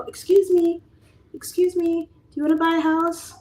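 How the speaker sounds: high, loud, hammed-up accosting voice; pushy realtor. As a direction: play the needy salesperson. Mock realtor accosting strangers.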